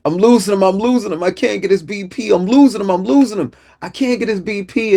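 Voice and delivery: high-pitched, frantic paramedic yelling to the driver; urgent retold yell. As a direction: yell it up front in a panicked high voice. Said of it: "he begins to yell to the driver".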